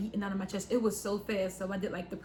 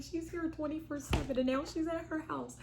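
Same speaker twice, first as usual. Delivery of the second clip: high-pitched protest to oneself; retold complaint; 8 semitones higher than usual. Daughter finding mom has left, high-pitched complaint. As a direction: protest it in a much higher voice.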